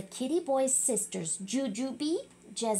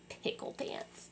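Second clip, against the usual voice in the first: whispered nickname; whispered name; 13 dB quieter than usual. Real, not acted. Reader whispers the kitty's nickname. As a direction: breathe the name like a secret. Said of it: "that's his nickname".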